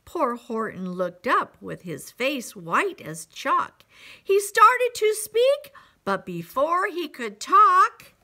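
suspenseful build, rising high and loud; story read to children. A narrator's reading. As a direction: build the suspense for young listeners. Reads picture book to children.

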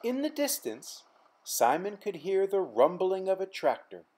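usual speaking voice

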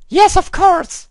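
put-on the girl persona insisting, shrill and loud; squeaky girl voice contradicting him; English girl voice. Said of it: "girl persona answers back".

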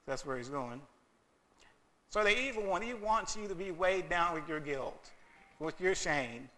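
ordinary talk

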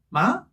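"마" rising in pitch; second tone. Shown as a demonstration. Teacher answering for second tone, "마" rising. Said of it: "이 성이니까 뭐죠".

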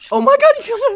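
high, loud panicked yell; alarmed shout. Real, not acted. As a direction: cry out as the chaser closes in. Chased in the game.

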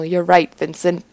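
ordinary delivery

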